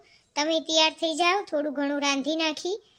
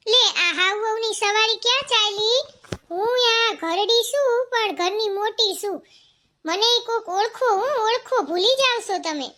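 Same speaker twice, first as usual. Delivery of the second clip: put-on old woman voice; 5 semitones higher, 4 dB louder than usual. The elder old woman demanding to be recognised, higher and louder. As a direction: play the grumbling household elder.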